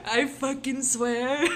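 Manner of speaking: laughing through the line; slow cranky voice cracking up; laughing old timer voice. Acted. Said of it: "I'm in my sixties".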